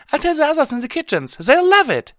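very high, loud, fast squeak; squeaky, thrilled pony voice; excited squeal. Acted. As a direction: rush it out gleefully. Pinkie excited about party plan.

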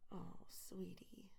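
slow, soft tender whisper. Acted. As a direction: breathe it out gently.

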